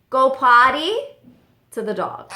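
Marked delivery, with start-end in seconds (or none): 0.1-0.9 high, loud imitation of the parrot. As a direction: squawk it out like the parrot. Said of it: "And then my bird goes".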